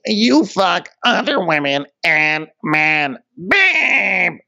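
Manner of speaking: high, slow put-on voice, ends in a shouted babe; mock catty taunt. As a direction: sneer it out and explode on babe. Wendy quoted in mock voice.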